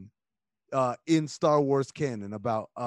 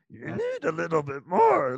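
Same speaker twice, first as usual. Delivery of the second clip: guttural, higher creature voice; Yoda; 5 semitones higher than usual. Shown as a demonstration. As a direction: demonstrate the little sage with throat in it. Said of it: "a guttural kind of".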